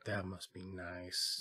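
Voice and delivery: low, slow and soft dim-witted; simple-minded voice. Retold or imitated. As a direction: drawl it out wistfully and dim. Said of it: "he's real simple-minded".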